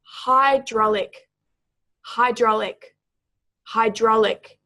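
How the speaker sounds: "hydraulic" repeated slowly, stressed on DRAUL, with pauses between; stress on second syllable. Shown as a demonstration. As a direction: model "hydraulic" for learners, second syllable strongest. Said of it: "emphasis is on the second syllable".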